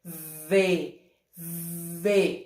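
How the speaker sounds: "V" low and drawn out with a vibrating onset; slow, low vvv-buzz into "V"; buzzing V sound. Shown as a demonstration. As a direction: hold the buzz at the start of "V". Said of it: "ele tem uma vibraçãozinha aqui".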